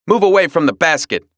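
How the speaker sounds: a touch of a firm, fast command; dog guarding breakfast; stern warning. Acted. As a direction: order the raccoon off. Dog confronts raccoon.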